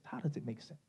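near whisper. Acted. Repeated question, dropped low.

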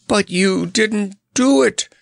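high, loud triumphant cry; triumphant shout. A narrator's reading. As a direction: crow it out in triumph. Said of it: "shouted Notta triumphantly".

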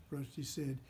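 usual speaking voice

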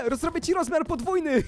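high-pitched, giggly mischief; gleeful laughing. Real, not acted. Player trolls a friend by doubling her size, giggly and high. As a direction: say it through rising giggles.

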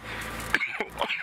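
harsh real coughing; coughing fit. Real, not acted. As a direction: cough hard after swallowing a bug. Just swallowed a bug.

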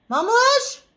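high, loud call of the pet name. Real, not acted. Owner calling her cat Mamuş loudly.

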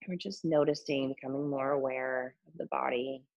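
calm body scan guidance; guided meditation. Acted.

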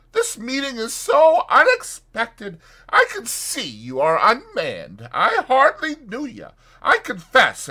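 high, loud, effusive gentleman; flustered doctor. A narrator's reading. Doctor placates Fetz before his train, high and effusive. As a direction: gush on hurriedly to smooth over the meeting.

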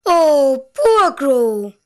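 ordinary delivery, nothing marked

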